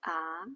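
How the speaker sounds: "ah" rising like a question; rising second tone. Shown as a demonstration. Teacher likening second tone to questioning, rising pitch. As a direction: ask "ah?" with rising intonation. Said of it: "questioning someone with a rising intonation".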